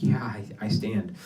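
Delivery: whispered sheepish admission. Retold or imitated. Admitting to classmates he stands, whispered. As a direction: confess it quietly, embarrassed.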